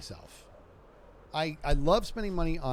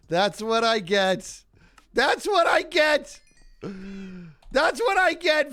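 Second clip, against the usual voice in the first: slow, then higher and louder with each repeat; building self-scolding yell; 6 semitones higher, 7 dB louder, slower than usual. Real, not acted. Scolding himself after the kill, building from slow to loud. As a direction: work up from a groan to a cry. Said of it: "repeats the line escalating".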